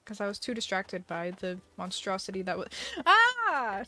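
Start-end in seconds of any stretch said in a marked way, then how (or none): 3.1-3.8 high startled shriek; startled scream. Real, not acted. Streamer hit by a jump scare, startled scream. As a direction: cry out in sudden fright.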